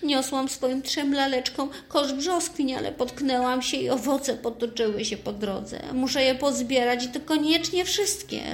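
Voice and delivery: put-on high, sweet little girl, slightly tearful; a small Japanese girl asking for help. Little Rika telling the elf about her spilt peaches, tearful.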